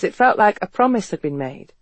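ordinary delivery, nothing marked

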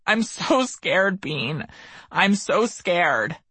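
high, slow, drawn-out plea; narrated anguish, stretched and high-pitched; crying in fear. A narrator's reading. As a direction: voice his terror slowly, high but not shouted. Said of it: "His cries and deep breaths merged".

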